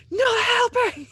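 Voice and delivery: high, loud shriek over comms; shrill high-pitched yell; screaming. Acted. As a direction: scream it into the mic. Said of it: "the scream that's going on right now".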